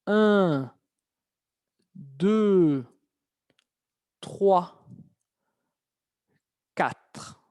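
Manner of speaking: isolated words with long pauses between; model for repetition. Shown as a demonstration. Teacher has students repeat after them, counting word by word. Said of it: "Repiten después que yo".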